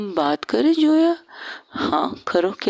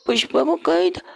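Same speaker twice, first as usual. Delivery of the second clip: normal talking voice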